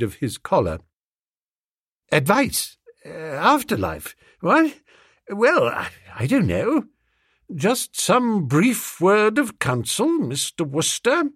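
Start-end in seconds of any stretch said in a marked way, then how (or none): 2.1-6.9 high, flustered stammering toff; flustered young gent. A narrator's reading. Flustered Wooster caught off guard. 7.5-11.3 firm. A narrator's reading. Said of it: "said Miss Tomlinson firmly".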